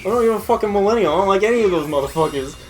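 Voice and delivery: indignant rush of words; fast mock rant. YouTuber denying he is a millennial, fast mock rant. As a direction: rattle it off fast and irritated. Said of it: "He's like".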